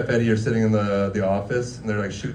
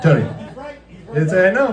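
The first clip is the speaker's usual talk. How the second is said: usual speaking voice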